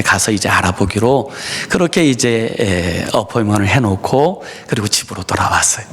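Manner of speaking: ordinary talk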